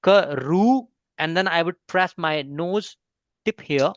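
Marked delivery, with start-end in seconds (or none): 0.0-0.9 karu stretched slowly, ending on an open oo; slow karu, long open final vowel; no N sound. Shown as a demonstration. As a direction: draw out karu with no n at the end. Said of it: "I would not emphasize on na sound".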